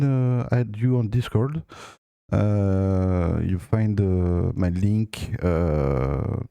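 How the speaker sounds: English spoken with a strong French accent; French speaker talking English. Real, not acted. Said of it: "j'ai un accent anglais très, très pourri".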